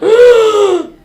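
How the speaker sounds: loud, high mock gasp of alarm. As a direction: gasp like a shocked child. Child hearing bad news.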